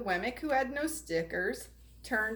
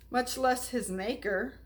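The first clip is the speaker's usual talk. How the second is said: plain reading voice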